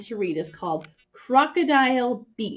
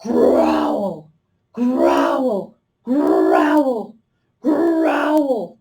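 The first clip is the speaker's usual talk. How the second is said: playful loud bear growls; 4 semitones higher, 7 dB louder, much slower than usual. Shown as a demonstration. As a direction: growl four times like a ferocious bear. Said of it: "you're going to growl four times".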